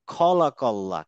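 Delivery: খলাকাল্লা starting on a rounded khaw; heavy, round opening on খলাকাল্লা; correct heavy kha. Shown as a demonstration. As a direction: say খলাকাল্লা with a full-mouthed first syllable. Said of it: "অ্যারাবিয়ানরা উচ্চারণ করবে".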